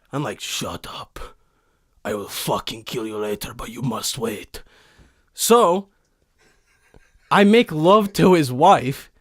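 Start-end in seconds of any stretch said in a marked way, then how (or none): 0.4-4.6 low, quiet, menacing growl, slowing at the end; Viking voice. Pretend. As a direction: calmly threaten like a brutal warrior. Viking silences the driver.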